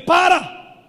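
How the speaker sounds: loud command para!; sharp stop. Acted. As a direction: cut the reader off with para!. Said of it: "Eu digo".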